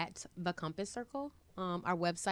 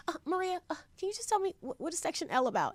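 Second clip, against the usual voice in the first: meek timid self; 12 semitones higher, faster than usual. Retold or imitated. Timidly phoning Maria for advice.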